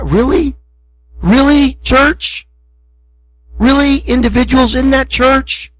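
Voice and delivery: high, incredulous rising questions; frustrated God. Retold or imitated. God to the church, imitated incredulous frustration. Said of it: "Can you understand his frustration".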